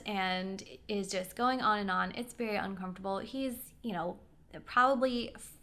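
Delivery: ordinary delivery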